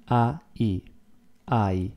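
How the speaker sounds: "A, I" split, then "I" softly with a sudden change; Italian "I" as two sounds. Shown as a demonstration. Teacher showing students the Italian I is two words. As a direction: break "I" into A then I, no glide. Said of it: "is like two words".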